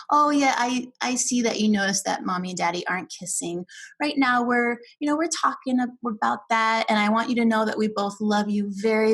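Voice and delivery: ordinary delivery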